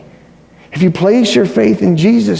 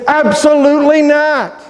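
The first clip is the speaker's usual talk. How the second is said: emphatic no; 7 semitones higher than usual. Retold or imitated. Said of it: "very strong language".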